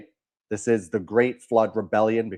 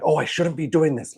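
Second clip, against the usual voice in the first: higher-pitched imitation of a scared informant; mock nervous; 5 semitones higher than usual. Host mocking the actor's weak acting, mock nervous. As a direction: fret as if caught leaking secrets. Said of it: "serious and nervous and scared".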